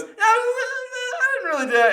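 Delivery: squeaky, very high, slow and loud; hammed-up guilty falsetto; squirming denial. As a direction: wriggle out of it in a tiny high pitch. Acted dad squirming out of admission.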